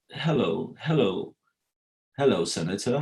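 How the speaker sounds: lightly put-on old-movie American English accent; formal American English accent, lightly imitated; proper American English accent. Shown as a demonstration. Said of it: "it's almost like this".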